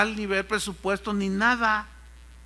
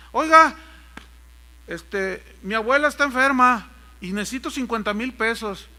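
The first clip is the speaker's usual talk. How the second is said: mock pleading brother; 3 semitones higher, 7 dB louder than usual. Mocking congregants begging money.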